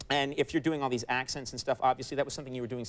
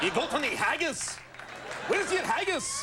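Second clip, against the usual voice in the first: a higher, hammed-up Scottish brogue; mock Scottish accent; 12 semitones higher than usual. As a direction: play a jokey Scot on a prank call. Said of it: "What kind of prank call involves a Scottish accent".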